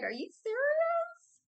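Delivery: high, slow disbelief; incredulous. Retold or imitated. Reader imagining a partner's demand, incredulous and high. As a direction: stretch it out in shocked disbelief, way up high. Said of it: "I'd be like".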